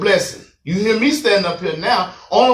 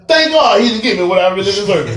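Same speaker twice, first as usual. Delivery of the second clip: usual speaking voice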